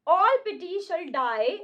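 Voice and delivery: slow, grave Shakespeare reading; dramatic recitation. Acted. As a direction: declaim each word slowly. Reads Shakespeare lines slowly. (धीमा नाटकीय पाठ)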